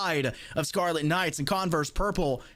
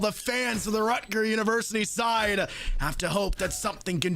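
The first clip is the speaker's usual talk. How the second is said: rising shouted play-by-play; esports caster; 5 semitones higher than usual. Acted. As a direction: build the call to a roaring finish. Live play-by-play climax.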